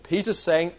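ordinary talk

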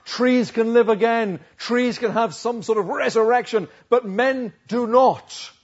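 loud, high declaration, slowing at the end; emphatic preaching. Real, not acted. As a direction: drive the contrast home forcefully.

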